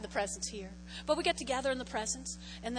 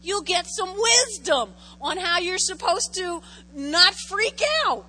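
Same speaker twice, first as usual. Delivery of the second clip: mock freak-out; 6 semitones higher, 11 dB louder, slower than usual. Mock panic in sermon.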